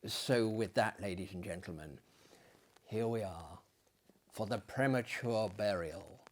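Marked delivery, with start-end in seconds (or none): none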